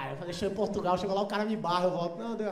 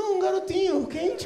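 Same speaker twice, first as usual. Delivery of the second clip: loud, chirpy waiter; 8 semitones higher, 4 dB louder than usual. Retold or imitated. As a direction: offer it cheerfully. Waiter offers a hot coffee.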